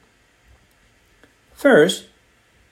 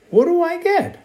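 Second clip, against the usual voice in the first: slow curious question; 14 semitones higher than usual. A narrator's reading. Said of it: "Andrew slowly asked his dad".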